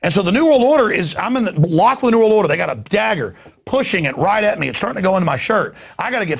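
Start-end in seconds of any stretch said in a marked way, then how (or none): none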